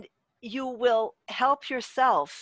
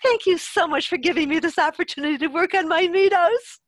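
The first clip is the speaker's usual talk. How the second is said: higher, louder, over-the-top thankful; exaggerated gratitude; 7 semitones higher, 5 dB louder than usual. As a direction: gush the thanks as if sweetly performing for the money. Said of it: "you tell the child".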